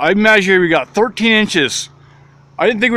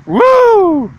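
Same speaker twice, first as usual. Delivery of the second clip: excited woo; 4 dB louder than usual. Real, not acted. Excited sign-off cheer.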